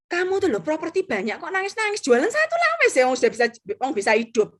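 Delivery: high-pitched, incredulous telling-off; acted-out nagging disbelief; exasperated scolding. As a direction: scold a friend who won't stop crying. Retelling her scolding of tearful client.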